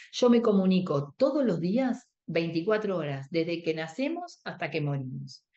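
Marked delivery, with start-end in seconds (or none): none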